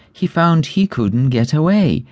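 ordinary delivery, nothing marked

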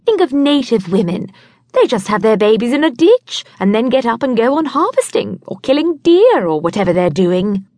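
put-on a confident young woman laying down the law; lightly voiced know-it-all friend; brisk bossy friend. A narrator's reading.

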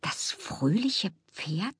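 soft, slow, whispered question; murmuring. A narrator's reading. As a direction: murmur it in puzzled disbelief. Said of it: "murmelte sie".

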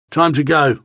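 lightly gruff guard's order. A narrator's reading. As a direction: give the order with some gruffness. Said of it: "he said in a gruff voice".

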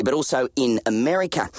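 usual speaking voice

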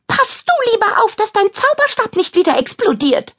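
high, shrill-pitched scolding witch. Acted. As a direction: snap at her rival from up high. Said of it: "schimpft Kadabra".

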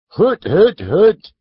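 high, loud mocking hoots; the barber scoffs at a name; scoffing barber. A narrator's reading. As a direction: scoff at the question. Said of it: "said the barber".

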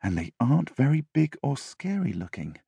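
soft inner voice; a private thought, held soft. A narrator's reading. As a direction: say it quietly to yourself. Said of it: "he thought".

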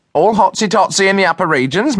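voiced high, chirpy cook. A narrator's reading. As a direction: play the jolly big cook. Cook's voice in story.

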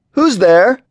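loud call into the dark. A narrator's reading. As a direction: call out, startled, into the dusk. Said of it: "I called out".

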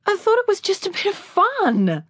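he pleads, hurt and thrown; distressed. Acted. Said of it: "His voice was distressed".

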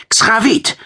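a high, loud gasp of the name. A narrator's reading. Fighter gasps the name at his lover. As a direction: push the word out mid-fight, panting. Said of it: "keuchte Akoschei".

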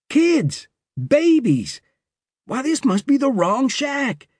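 high, startled cry of disbelief; shocked outburst. A narrator's reading. Cowboy finds babies in the shack, shocked high cry. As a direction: blurt it out in sudden alarm.

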